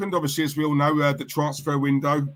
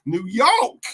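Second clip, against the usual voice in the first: New York in a hammed-up American accent, higher; mock American accent; 7 semitones higher, 5 dB louder than usual. Shown as a demonstration. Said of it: "I've said it so wrong".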